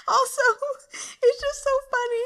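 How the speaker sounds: high, fast, giggling words; giggly. Real, not acted. Vlogger still giggling at the crude character, high laugh.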